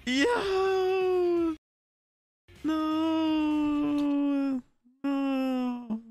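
high, crying-out shout of protest; anguished high yell. Real, not acted. Fan yelling in dismay at the episode ending. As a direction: cry out in disbelief.

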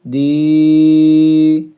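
"দি" with a long level vowel; tarikan satu alif. Shown as a demonstration. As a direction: hold "দি" out steadily on one note. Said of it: "মদের হরফ এক আলিফ".

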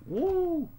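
high whooping cheer; happy woo. Real, not acted. Player cheers after getting his car back, whooping. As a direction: celebrate the win with a whoop.

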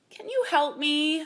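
slow, high, pitiful plea; sorry, sniffly creature voice; sniffling plea. A narrator's reading. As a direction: beg for help as if tearful. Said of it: "the dragon sniffled".

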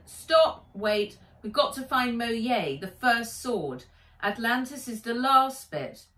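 loud, high urgent halt; urgent stop. Acted. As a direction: cut them off firmly. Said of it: "Nat held up a hand".

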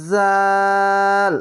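জাল with a buzzing start and very long aa; tongue slightly out, hold জাল level; chant-like, drawn-out জাল; three-alif stretch. Shown as a demonstration. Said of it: "তিন আলিফ টেনে পড়বেন".

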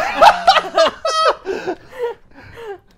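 loud rising cackle; loud laugh. Real, not acted. Narrator cracks up at his own shriek, laughing loudly.

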